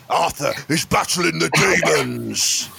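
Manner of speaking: high, slow tormented roar; hammed-up Brummy gangster cry; Arthur. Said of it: "and his brother".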